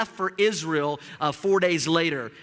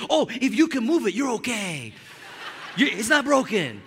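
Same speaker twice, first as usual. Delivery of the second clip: friend's voice; 3 semitones higher than usual. Retold or imitated. Friend casually reassures injured pastor.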